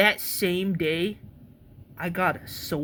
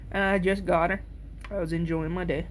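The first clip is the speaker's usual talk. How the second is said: ordinary talk, nothing marked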